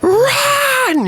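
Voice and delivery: sudden very high shriek; shrieked run. Real, not acted. Player shrieking at danger in the game, very high. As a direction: scream the word run. Urging during gameplay.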